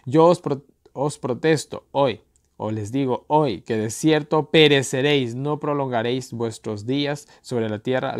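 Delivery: loud, solemn warning read slowly; scripture reading. Acted. Preacher reading the warning verse aloud, solemn and loud. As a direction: pronounce the warning of perishing.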